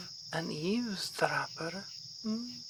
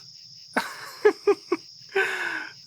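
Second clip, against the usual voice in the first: high airy scornful laugh; a high mocking laugh at the impostor's fake name; amused breathy laugh; 12 semitones higher, 4 dB louder than usual. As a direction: laugh off the obvious lie.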